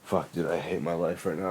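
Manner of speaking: normal talking voice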